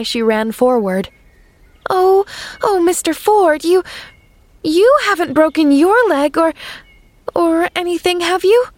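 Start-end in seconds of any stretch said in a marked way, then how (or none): 1.9-8.7 much higher and louder, breathless alarm; a frightened child rushing to help; alarmed gasping girl. Acted. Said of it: "she gasped".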